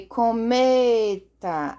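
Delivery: "cometa" with ME stretched long and loud; slow "cometa", leaning heavily on "me"; stress on middle syllable. Shown as a demonstration. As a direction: draw out the middle of "cometa": co-MEEE-ta. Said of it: "a sílaba tônica não é oxítona".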